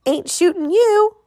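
put-on high, slow, whiny kid protest; drawn-out high-pitched whine; whining. A narrator's reading. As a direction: whine back like a sulky boy. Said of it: "Russell whined".

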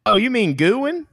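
"Goodwin" said GOOD-win for the town spelled Guin; high, surprised "Oh, you mean Goodwin?"; correcting a visitor, "Goodwin" said GOOD-win; local pronunciation. Retold or imitated. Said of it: "And you say".

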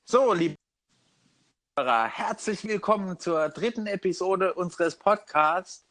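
slow showy intro voice; podcast announcer. Acted. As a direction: announce the show title grandly.

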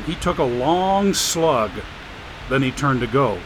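ordinary delivery

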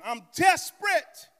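big, high, slow cry of desperate; the peak of the build; loud slow climax. Real, not acted. Preacher at the peak of his repeated plea, shouting.

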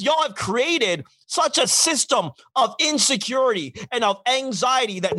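ordinary delivery

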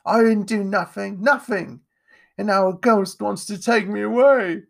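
put-on high, loud, distraught boy; upset child crying out; tearful protest. A narrator's reading. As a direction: wail your innocence in desperation. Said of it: "His sobbing grew louder".

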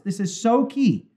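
ordinary delivery

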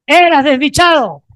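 loud and high, proclaiming to a neighbour; shouted declaration. Acted. Preacher shows what to tell the person beside you, shouting. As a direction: call it out with fervour. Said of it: "voltea de al lado y dile".

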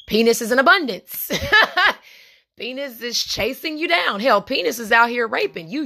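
laughing. Real, not acted.

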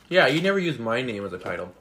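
ordinary talk, nothing marked

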